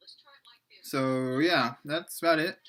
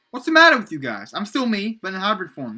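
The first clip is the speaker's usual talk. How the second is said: mildly angry protest, loud and high at first; 5 semitones higher, 7 dB louder than usual. A narrator's reading. Matt protests to the group, mildly angry. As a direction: start with an indignant outburst, then ease off. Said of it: "Matt said to the gr-, group angrily".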